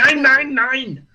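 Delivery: loud, high mock German protest; mad German. Angry German gamer objecting, mock shouting. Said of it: "then when they're mad".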